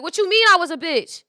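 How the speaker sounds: loud, high offended squawk; hammed-up indignant shout; mock outrage. Retold quarrel, acting offended.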